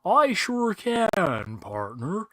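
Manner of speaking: high, slow cowboy drawl; bad American accent. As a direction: ham up a bad Southern twang. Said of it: "And she's like".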